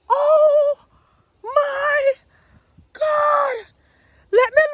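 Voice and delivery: high, loud and spaced out; each word burst out with joy; real excitement; excited shout. Real, not acted. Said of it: "I am so excited!".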